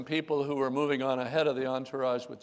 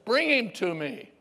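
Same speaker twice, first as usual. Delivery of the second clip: commanding call; 7 semitones higher than usual. Acted. Jesus calling to the crowd, firm command.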